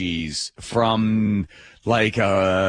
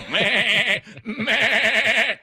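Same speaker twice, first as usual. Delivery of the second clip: high, quavering bleat; sheep bleating; 10 semitones higher than usual. Retold or imitated. Traveler protesting being treated like animals, bleating. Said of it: "I'm doing the sheep".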